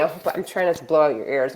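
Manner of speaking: ordinary delivery, nothing marked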